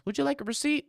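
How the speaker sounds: high-pitched mock server voice; bartender impression. Acts out bartender offering receipt.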